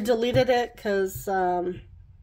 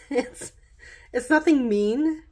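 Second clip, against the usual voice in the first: amused, higher-pitched, laughing through the words; 6 semitones higher, 4 dB louder than usual. Real, not acted. Tarot reader amused by a suddenly nice message, laughing. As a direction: let a real giggle break into the reassurance. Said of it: "I'm laughing 'cause it's like".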